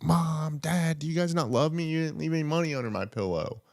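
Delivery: put-on high whiny child; kid voice. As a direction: whine like a sad kid. Kids whining to parents.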